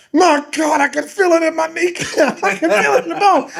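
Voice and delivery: high, anguished imitation wail; mock agony. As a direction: imitate a panicked boy in pain. Acts boy in agony.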